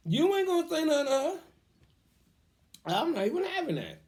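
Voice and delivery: defending his right to parent, a loud high shout; mock furious shout. Scolding someone who overstepped her.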